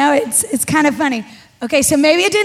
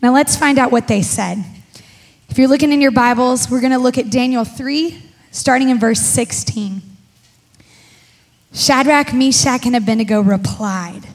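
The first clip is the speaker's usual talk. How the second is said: usual speaking voice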